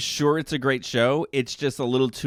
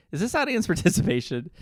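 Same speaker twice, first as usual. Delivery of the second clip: high, laughing question; half-laughing, can't believe it; laughing disbelief; 7 semitones higher than usual. Retold or imitated. Asking Jeremy in disbelief, laughing.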